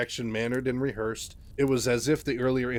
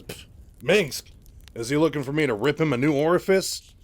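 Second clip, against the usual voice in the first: scornful scoff; 4 semitones higher, 7 dB louder than usual. Acted. Raynor scoffs at Minsk's message.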